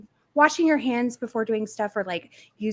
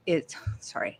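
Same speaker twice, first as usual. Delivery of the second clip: whisper; 6 semitones lower, 6 dB quieter than usual. Real, not acted. Aside, dropping the voice.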